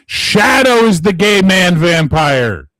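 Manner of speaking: high, loud, slow proclamation; dramatic pronouncement. Pretend. Settling the vampire argument with a loud proclamation. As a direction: declare it like a grand announcement. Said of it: "I'm gonna say this one time and one time only".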